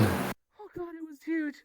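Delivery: high, loud freaked-out voice. Real, not acted. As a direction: still rattled by a spider. Spider scare.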